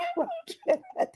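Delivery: short high laugh; a laugh that bursts out high; sharp high laugh; high giggle. Real, not acted. Laughing at courtroom clip.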